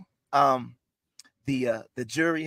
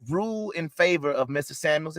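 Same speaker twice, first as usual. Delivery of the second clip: usual speaking voice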